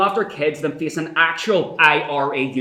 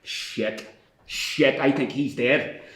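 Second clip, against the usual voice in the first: hushed dismay; 7 semitones lower, 7 dB quieter, much slower than usual. Acted. Realising prisoner died.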